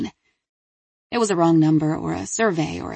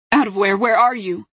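louder, urgent, panicked questions; frantic mother; 4 semitones higher, 4 dB louder than usual. A narrator's reading. Delia questions her screaming daughter before the line dies, frantic. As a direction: demand an answer in alarm.

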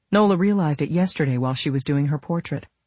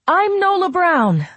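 very high, loud, drawn-out and slow; triumphant call; 6 dB louder, slower than usual. A narrator's reading. Camille waving her boarding pass, triumphantly calling out. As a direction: shout it out like a lottery winner.

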